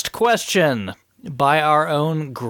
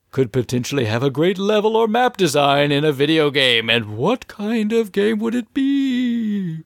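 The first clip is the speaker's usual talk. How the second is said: ghost voice; 6 semitones higher than usual. Acted. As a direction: read it like a spooky ghost. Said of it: "I'm gonna put on my best Grey Ghost voice".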